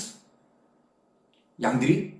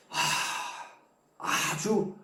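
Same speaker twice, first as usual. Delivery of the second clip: ordinary talk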